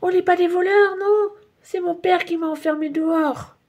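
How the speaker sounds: put-on child shouting his defence. A narrator's reading. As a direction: cry out the injustice. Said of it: "crie Nicolas".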